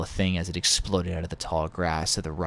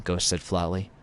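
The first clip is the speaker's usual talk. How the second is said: ordinary delivery, nothing marked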